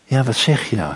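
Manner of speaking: hushed, falling question; softly asking mother. Acted. Moeder leans down to her kleine meisje, soft and attentive. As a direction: bend close and ask softly. Mother bends down to hear child.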